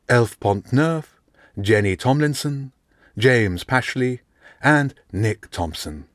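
calling each name out slowly; slow roll call. Acted. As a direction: read the names like a roll call. Teacher reading out names slowly.

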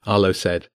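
ordinary delivery

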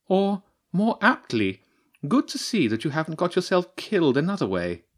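high, sly mocking badger voice; a scientist badger gloating over her dinner; badger priestess. The badger priestess teasing James, high and mocking.

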